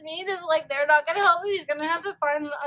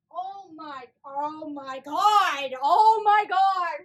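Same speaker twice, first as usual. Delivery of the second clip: ordinary delivery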